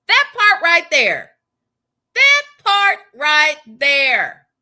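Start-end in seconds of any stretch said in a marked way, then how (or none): 2.1-4.5 loud, slow, word by word; emphatic slow repeat. Real, not acted. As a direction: space out every word firmly.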